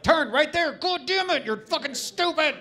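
high, loud exasperated yelling; dickhead boyfriend. Pretend. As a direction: berate the driver like a jerk. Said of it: "like a dickhead boyfriend".

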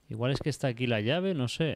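ordinary talk, nothing marked

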